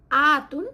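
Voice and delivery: আতুন said loud and sharp; emphasised ending. Shown as a demonstration. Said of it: "মানে রাফা status feminine". (জোরে আতুন উচ্চারণ)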